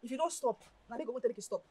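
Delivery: ordinary talk, nothing marked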